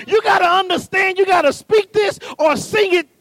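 loud, rising sermon cadence; fiery preacher climax; preaching at full force. Acted. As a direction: drive it home to the congregation.

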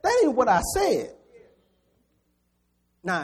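loud, high, driving sermon delivery; fired-up preacher pounding home the point; preaching at full force. Acted. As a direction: preach it hard to the congregation.

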